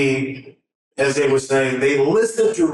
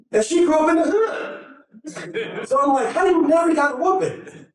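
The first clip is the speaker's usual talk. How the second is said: much higher, laughing disbelief; amused disbelief; 12 semitones higher than usual. Retold or imitated. Husband laughing at his wife's whipping-free childhood, amazed and much higher. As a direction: marvel that she was never whipped.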